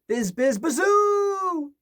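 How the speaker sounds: high, loud wind howl rising on bazoo; eerie wind whoosh. A narrator's reading. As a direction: moan it like wind in the cracks. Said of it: "it went".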